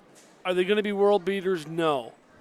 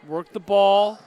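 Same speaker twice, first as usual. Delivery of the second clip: shouting; 3 semitones higher, 10 dB louder, slower than usual. Real, not acted. Urging team from booth.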